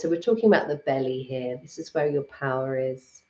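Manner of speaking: calm, unhurried meditation guide with long pauses; guided meditation. Acted. Guiding live viewers through a Reiki meditation, calm and unhurried. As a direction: lead the listeners gently into their breath and body.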